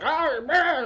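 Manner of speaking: high, loud surprised yelp; mock startled cry. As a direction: yelp as sleepers grab you. Grabbed by sleeping couple.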